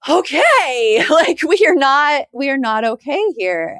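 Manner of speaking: loud, laughing, slow okay; laughing disbelief. Retold or imitated. Reacting to a toxic friend, laughing in disbelief. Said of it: "I'm like".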